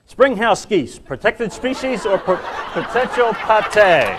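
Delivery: big comic top-ten reveal; countdown announcer. Acted. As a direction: announce each entry with mock grandeur.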